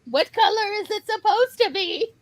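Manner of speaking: put-on high, indignant little-kid voice; mock toddler outrage. Toddler upset that the banana is yellow, high mock indignation. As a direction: demand it like a cranky toddler.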